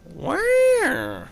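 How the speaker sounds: very high, startled squeak; high-pitched surprised yelp. Acted. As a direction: jump up high on the word. Character's sudden startled question.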